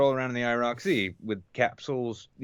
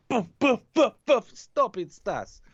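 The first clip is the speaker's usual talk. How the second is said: mom scolding; 13 semitones higher, slower than usual. Retold or imitated. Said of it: "just like".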